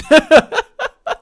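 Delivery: high, loud burst of laughter. Real, not acted. Streamer laughing at looking like a moron, amused. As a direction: laugh out loud at your own story. Laughs at his own story.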